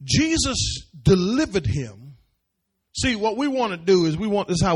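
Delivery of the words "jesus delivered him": ordinary delivery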